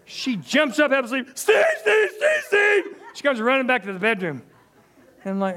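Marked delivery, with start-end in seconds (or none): 1.3-2.8 high, loud frantic shout; alarmed calling. Retold or imitated. Startled wife shouting for her husband, high and loud. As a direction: call him in panic, half asleep.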